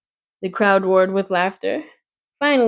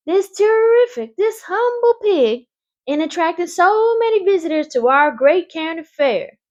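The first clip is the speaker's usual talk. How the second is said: high, formal announcer voice; fair announcer; 11 semitones higher, 3 dB louder than usual. A narrator's reading. Announcer awarding the prize at the fair, formal and high. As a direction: announce the prize grandly to the crowd.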